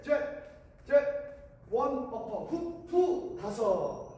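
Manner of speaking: rhythmic shouted workout calls; boxing coach calls. Shown as a demonstration. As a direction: count the punches like a coach.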